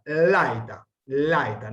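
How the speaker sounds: laeta, stress on the A, ae in one syllable; laeta, lae as one gliding syllable; diphthong demo. Shown as a demonstration. Teacher modelling laeta for the class. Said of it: "No podemos acentuar la E".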